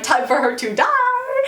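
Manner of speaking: high, drawn-out comic threat; hammed-up killer, slow and high; mock menace. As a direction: sing out the threat with a laugh. Villain voiced, laughing.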